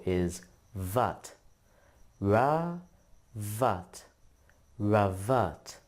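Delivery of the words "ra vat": Da and zer apart with a pause, Da pitched up; split into syllables. Shown as a demonstration. Teacher sounding out Dazer syllable by syllable. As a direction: sound out each half. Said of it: "The first syllable is ra".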